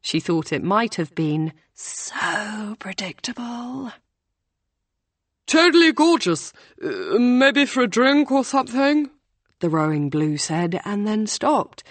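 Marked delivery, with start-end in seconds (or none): 1.7-3.9 soft unvoiced whisper. A narrator's reading. Hannah guessing what April mouthed, whispered. 5.5-9.1 put-on much higher, louder, eager young man; nervous rowing boy. A narrator's reading. Rowing blue asking Hannah out, eager and awkward.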